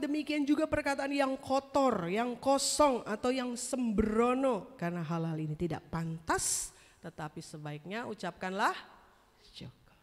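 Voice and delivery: loud, declaiming Bible reading; scripture reading. Acted. Preacher reading Ephesians aloud, loud solemn proclamation. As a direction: proclaim the verse to the congregation.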